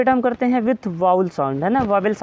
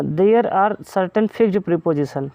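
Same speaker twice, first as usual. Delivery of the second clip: slow dictation; much slower than usual. Shown as a demonstration. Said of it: "reading rule out slowly".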